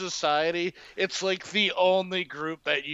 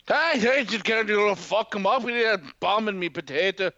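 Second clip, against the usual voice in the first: put-on jokey stage-Irish accent, a touch louder at the start; mock Irish; 3 semitones higher, 3 dB louder than usual. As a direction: play a cartoon Irishman in a thick Irish brogue. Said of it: "Your impression of an Irishman is spot on".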